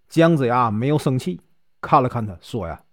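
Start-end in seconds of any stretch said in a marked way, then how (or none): none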